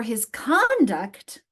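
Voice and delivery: conduct as a noun, CON-duct, first syllable drawn out slowly and loud; stress on first syllable. Shown as a demonstration. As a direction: hit and stretch the CON of conduct. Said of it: "we stress the first syllable".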